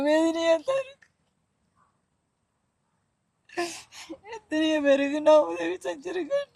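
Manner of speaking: high, soft, tearful voice breaking; real weeping while speaking of her pain; crying. Real, not acted. Mother telling the visitor her grief, weeping. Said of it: "சரி, அழாதீங்கம்மா".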